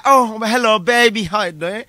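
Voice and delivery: a touch of a charmer's phone voice; sweet scented voice. Acted. Man sweet-talking his girl on the phone, lightly put-on sweet voice. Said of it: "that sweet scented voice".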